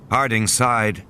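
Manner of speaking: usual speaking voice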